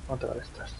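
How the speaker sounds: breathy whisper to yourself; whispered under breath. Real, not acted. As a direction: whisper otra de estas. Stealth kill, whispered.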